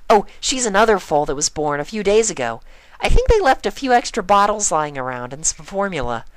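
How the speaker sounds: high, helpful chirpy pony; eager friend offering help; cheerful Pinkie. A narrator's reading.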